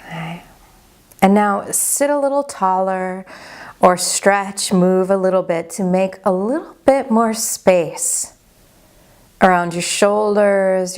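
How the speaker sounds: unhurried, even-toned body scan guidance; guided meditation. Acted. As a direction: guide them gently into calm, leaving space after each phrase.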